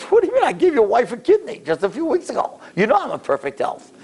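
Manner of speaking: high-pitched, protesting retort; indignant disbelief. Retold or imitated. Donor objecting to the lawyer's health check, indignant. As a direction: protest as if the demand is absurd.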